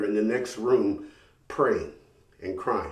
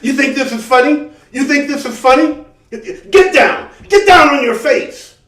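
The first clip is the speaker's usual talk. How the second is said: much higher and louder furious yelling; a terrified cop screaming orders; angry cop screaming; 12 semitones higher, 12 dB louder than usual. Retold or imitated. Scared cop screaming at him.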